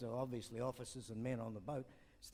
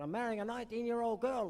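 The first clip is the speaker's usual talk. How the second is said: put-on higher, boastful old codger voice; lightly voiced old man bragging to his doctor; proud old man; 9 semitones higher, 5 dB louder than usual. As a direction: brag it like a spry old man.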